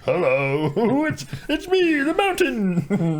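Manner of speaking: high, slow playful "it's me"; giggly, high mock sneaky Mountain; sneaky reveal voice. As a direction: peek out and announce yourself with a laugh.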